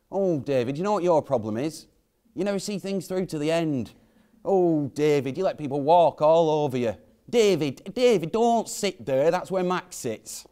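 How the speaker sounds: nagging mum. Retold or imitated. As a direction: imitate a mother finding fault with her son. Mum listing his flaws, imitated.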